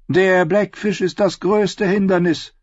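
high, loud, sly voice; scheming nephew. Acted. Edwin proposing his plan to the council, sly and high. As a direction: lay out the scheme with relish.

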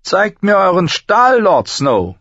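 high, loud command dropping at the end; scornful challenge. Acted. Master-at-arms challenges Jon to draw, scornful. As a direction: throw down the challenge with a sneer.

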